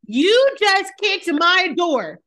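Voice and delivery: high, drawn-out outrage; slow, heated telling-off; indignant confrontation. Acted. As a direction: throw each accusation at her slowly, pitched high. Imagined confrontation with the neighbour.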